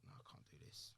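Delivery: barely voiced, breathing out the words; defeated whisper. Boy hassled by a bully whispers in defeat. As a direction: whisper it like you've given up. Retelling breaking down at school.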